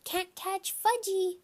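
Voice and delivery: put-on high, slow taunting child; toddler hollering. A narrator's reading. Toddler running off from the babysitter, high taunting holler. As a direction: tease in a little kid sing-song. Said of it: "he hollered".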